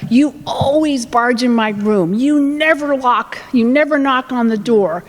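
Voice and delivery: put-on slow, emphatic teenage complaint; accusing teen. Teen complaining to parent about privacy, slow and accusatory. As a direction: lay the blame slowly, leaning on each accusation.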